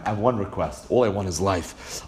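low, soft acted plea; humble request. Mashiach ben David asking Hashem for life, softly pleading. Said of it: "is gonna tell Hashem".

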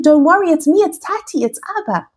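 put-on high, gentle father soothing a frightened child; calm him down, it's only Dad; reassuring father. Father soothing his terrified son at the door, gently reassuring.

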